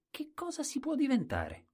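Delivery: put-on very high, little-boy question; small naive voice. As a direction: ask it wide-eyed in a tiny high voice. Colombin asks the king naively.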